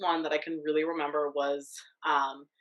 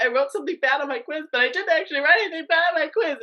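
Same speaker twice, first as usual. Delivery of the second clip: tearful plea; 9 semitones higher, 7 dB louder than usual. Retold or imitated. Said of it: "start crying even harder".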